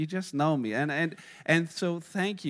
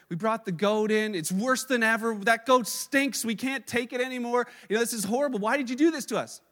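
higher and louder, exasperated complaint; distraught; 4 semitones higher, 4 dB louder than usual. Retold or imitated. Man back at the rabbi, higher, louder, distraught. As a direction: plead that it's worse than ever. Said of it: "more distraught than ever".

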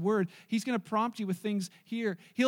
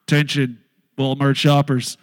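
booming store PA announcement, low and loud; toy megaphone; 6 semitones lower, 13 dB louder than usual. Retold or imitated. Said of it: "this megaphone".

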